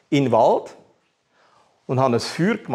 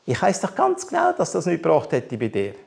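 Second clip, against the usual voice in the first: ordinary talk, nothing marked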